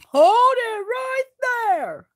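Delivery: high, slow, loud call to halt; shouted command. A narrator's reading. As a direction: bellow it out to stop them. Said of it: "said Dane Arrowfoot".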